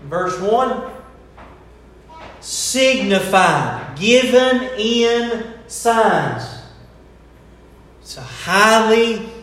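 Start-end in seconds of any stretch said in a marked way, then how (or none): 2.4-6.4 "Signified" slow and deliberate; "Signified" spelled out slowly for emphasis; stress on signified. Shown as a demonstration. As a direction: draw out "Signified" word by word. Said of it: "I emphasize another word".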